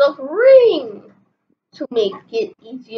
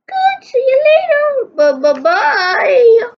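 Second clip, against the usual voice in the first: high, loud stammered bye; sing-song farewell, up high; high loud goodbye; 10 semitones higher, 5 dB louder than usual. Acted. Nurse says goodbye to Chief, high and loud.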